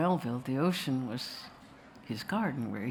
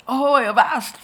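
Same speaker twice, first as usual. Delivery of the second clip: bellowed, much higher and much louder pirate-style call; angry sea captain; 8 semitones higher, 12 dB louder than usual. Retold or imitated. Captain Ahab bursting onto the deck, shouting angrily. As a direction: roar it across the deck like a raging captain. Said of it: "and he's angry".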